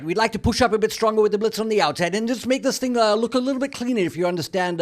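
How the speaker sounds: hammed-up Scottish coach addressing the players; attempted Scottish accent. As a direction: imitate a chap-ish coach with a Scottish accent. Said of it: "it's actually a Scottish accent".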